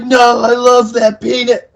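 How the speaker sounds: high slow silly whine; mock whine. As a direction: drag it out like a sulky kid. Mocking Riley's grief.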